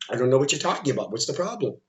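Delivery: soft, low, fast unbothered mumble; imitating someone calm and unimpressed; unconcerned, dismissive. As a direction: brush it off casually, as if nothing is wrong. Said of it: "calm and relaxed and unconcerned".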